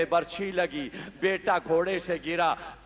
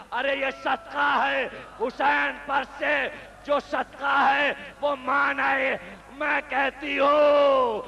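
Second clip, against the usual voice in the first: high, loud keening cry; wailing lament; 8 semitones higher, 4 dB louder than usual. Acted. Grieving mother cries out over her son's head, high loud wail.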